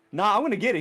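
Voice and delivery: mild retold stubbornness; casual refusal. Shinji refusing in the calmer retelling, mildly stubborn brush-off. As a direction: brush it off with light insistence.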